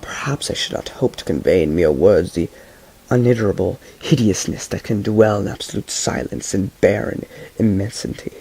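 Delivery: usual speaking voice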